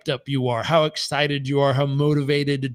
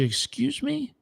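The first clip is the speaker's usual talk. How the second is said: incredulous; 11 semitones higher, 6 dB quieter, slower than usual. Retold or imitated. Coach reacting in disbelief to plan.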